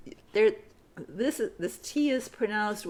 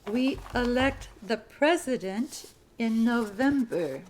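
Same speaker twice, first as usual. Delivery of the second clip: slow, even dictation pace. Shown as a demonstration. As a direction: repeat it clearly for the class. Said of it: "Sentence number fifty-two".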